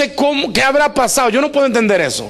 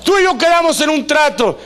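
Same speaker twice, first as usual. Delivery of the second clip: angry protest; 10 semitones higher than usual. Retold or imitated. Jacob confronts the father, shouting angrily high. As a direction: confront him furiously. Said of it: "se va enojado".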